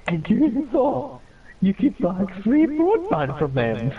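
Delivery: high, slow, incredulous mocking voice; mocking impression. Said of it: "I don't talk like that".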